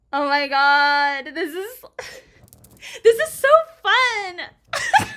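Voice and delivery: loud, drawn-out delight breaking into laughter; very excited. Real, not acted. Streamer thrilled by the game's surprise, very excited. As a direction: stretch it out, climbing high with laughter. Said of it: "I'm just very excited".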